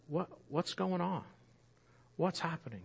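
acted onlooker, softly puzzled; quiet, dazed questioning; hushed bewilderment. Imagined bewildered onlooker at the cross.

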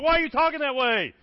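loud, high-pitched challenge; mock-outraged comeback; exasperated retort. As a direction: push it up in pitch, fed up and incredulous.

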